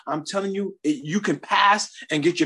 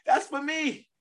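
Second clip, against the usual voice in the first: much higher, urgent pleading; frantic son. Retold or imitated. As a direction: beg mom to hand over the phone.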